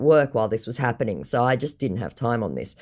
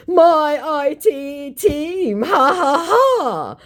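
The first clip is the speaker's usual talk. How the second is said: much higher, very slow mocking laugh; 13 semitones higher, 4 dB louder, much slower than usual. Scammer mocks the narrator's IT team, high and slow. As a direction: sneer it out with a fake laugh. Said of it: "mocks my Jack".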